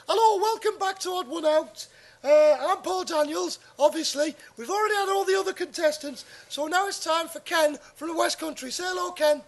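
bright TV presenter welcoming the audience, pitched high; Paul Daniels impression. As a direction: showman host on a Saturday night quiz. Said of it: "So I'm gonna be Paul Daniels".

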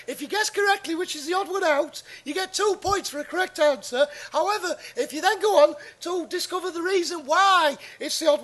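bright TV presenter welcoming the audience, pitched high; Paul Daniels impression. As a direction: showman host on a Saturday night quiz. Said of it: "I'm Paul Daniels".

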